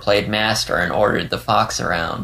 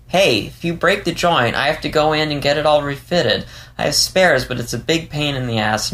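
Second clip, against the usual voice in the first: higher-pitched fox character voice, explaining a nuisance; pitched-up, put-out dialogue voice; Leo, irked and rambling; 5 semitones higher than usual. Acted. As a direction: ramble in a higher voice, then falter to a stop. Narrator voices Leo, complaining and explaining.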